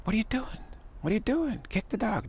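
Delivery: high, urgent "kick the dog"; can't believe nobody acts; incredulous. Retold or imitated. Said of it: "And they say".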